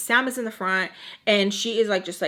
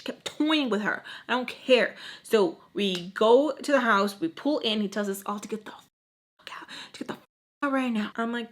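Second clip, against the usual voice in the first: normal talking voice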